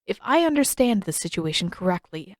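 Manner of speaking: plain reading voice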